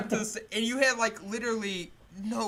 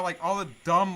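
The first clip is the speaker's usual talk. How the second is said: usual speaking voice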